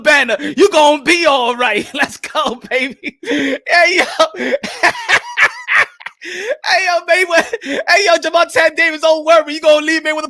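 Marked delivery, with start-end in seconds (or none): 4.1-6.2 high shrieking laugh. Real, not acted. Celebrating the fighter's news, high shrieking laugh.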